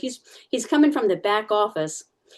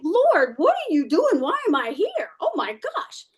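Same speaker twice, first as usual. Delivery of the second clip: high, bewildered cry to the Lord; alarmed plea; 10 semitones higher, slower than usual. Retold or imitated. Interviewee pleading with God amid the rat panic. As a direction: plead in disbelief at the chaos.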